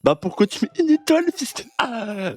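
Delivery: high, squealing owner's protest; mock outrage. Owner answers one-star customer, high mock outrage. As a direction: blow up over the rating, hammed-up. Acts out the angry owner's reply.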